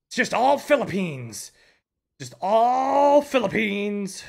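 slow, loud, drawn-out lament; exasperated drawl. Real, not acted. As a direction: stretch each word out in disbelief. Exasperated at the repeats.

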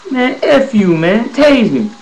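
high, loud furious dare; defiant yell. Retold or imitated. To the corporal holding a taser, furious yelled dare. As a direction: shout a reckless dare at the officer.

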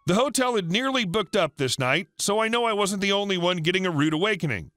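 ordinary delivery

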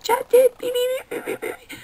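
squeaky high mock babble fading to a breath; mocking chatter. Mocking customers' chatter, high squeaky babble. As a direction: parody pointless gossip as high chirps.

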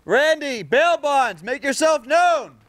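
high, slow call to someone hidden. Real, not acted. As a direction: shout slowly across the yard. Calling out across the property.

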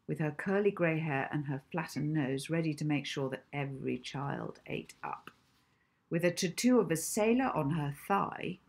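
usual speaking voice

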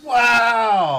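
sudden shouted wow; loud surprised wow. Real, not acted. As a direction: blurt out a startled wow. Reacting to game moment.

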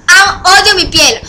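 ordinary talk

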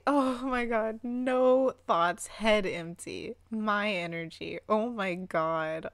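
normal talking voice

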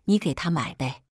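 usual speaking voice

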